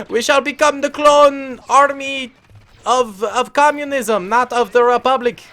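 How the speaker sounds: rally the comrades in a hammed-up Russian accent; bad Russian accent. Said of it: "I cannot do a Russian accent".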